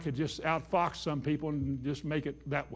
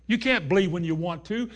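normal talking voice

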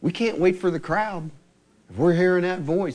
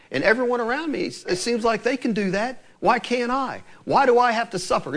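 mock complaint; 6 semitones higher than usual. Voicing a believer's complaint.

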